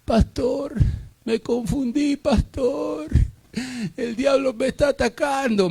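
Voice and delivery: high, pleading, contrite voice; mock the returning sinner's whine; hammed-up repentant plea; repentant member. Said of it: "viene el perro arrepentido".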